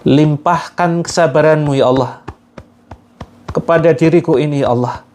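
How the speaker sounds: pleading prayer intoned phrase by phrase; earnest guided prayer. Acted. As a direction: lead the group in begging God for strength to forgive. Leads a forgiveness prayer.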